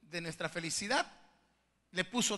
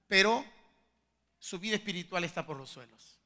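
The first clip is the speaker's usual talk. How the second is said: normal talking voice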